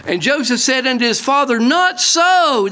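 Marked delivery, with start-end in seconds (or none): 1.6-2.7 hard and high on NOT; emphatic. Acted. Joseph firmly refusing his father, emphatic and high. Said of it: "This is emphatic".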